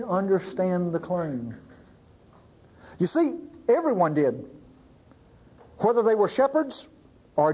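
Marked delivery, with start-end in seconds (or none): none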